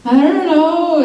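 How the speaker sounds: high, hesitant evasive voice; awkward dodge. Retold or imitated. Dodging Russ's question about Molly, high and awkward. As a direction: squeak it out, not wanting to answer. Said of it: "And I was like".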